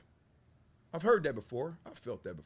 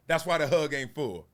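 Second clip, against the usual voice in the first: ordinary talk, nothing marked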